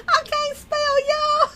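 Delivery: very high, laughing voice; helpless laughing. Real, not acted. Confessing bad spelling to viewers, helpless laughing. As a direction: squeeze it out through laughter.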